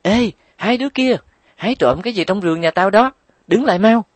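put-on high, loud yell at thieving kids; shouting girl. A narrator's reading. Phấn yells at the two kids stealing guavas, high and loud. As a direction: yell from the garden to stop them. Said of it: "cô bé la thật to".

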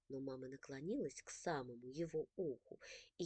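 ordinary delivery, nothing marked

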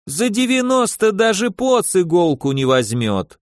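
wheedling tailor with a Yiddish lilt; yiddish accent russian. Acted. Tailor protests the price. (лёгкий идишский акцент портного)

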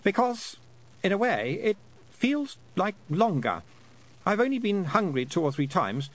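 put-on high, lightly boyish voice, puzzled; a lost boy working out how long he's been away; young boy Roland, unsure. A narrator's reading. Lost boy telling Tiffany about time, high and puzzled.

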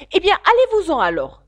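high, loud, huffy dismissal; sulky. Acted. Pigeon sulkily dismissing Alice from its nest. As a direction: send her off in a sulk. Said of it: "d'un ton boudeur".

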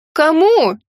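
high, loud shout into the storm; shouting over wind. A narrator's reading. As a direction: yell over the roaring gale. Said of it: "крикнула я".

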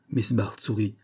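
ordinary delivery, nothing marked

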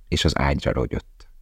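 ordinary delivery

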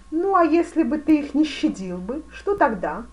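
loud, high demanding question; challenging. A narrator's reading. Grand duke challenges the fencing master. (громко, с вызовом)

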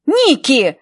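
woman shouting to break up a fight. Acted. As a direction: yell her name to stop the fight. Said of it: "закричала Хелен".

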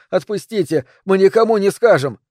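high-pitched frightened begging; desperate pleading. A narrator's reading. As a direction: plead to be let go. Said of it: "взмолился Джимми".

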